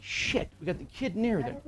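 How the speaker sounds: mildly frantic, caught off guard. Retold or imitated. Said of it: "What the fuck are you frantic for?".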